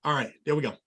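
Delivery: usual speaking voice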